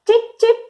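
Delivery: high, short peeps; bird chirps. Shown as a demonstration. Teacher revealing the bird's sound, chirping. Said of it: "The bird says tip".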